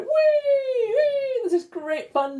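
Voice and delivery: very high, loud squeals of fun; gleeful shouts. A narrator's reading. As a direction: squeal with delight swinging on the rope. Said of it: "they shouted".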